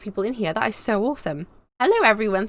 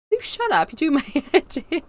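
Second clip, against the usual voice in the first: high-pitched mock exasperation; [name]; 8 semitones higher than usual. Companion telling her to stop the accent, mock exasperated. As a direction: imitate a fed-up companion, high and half laughing.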